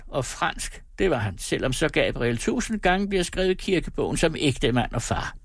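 put-on high, scolding old woman voice; shrill gossip letting loose. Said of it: "så klaredes mælet". (skinger gammel kone)

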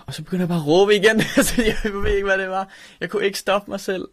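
real nervous laughter breaking in; laughing at a painful memory. Real, not acted. Laughs while recalling shouting.

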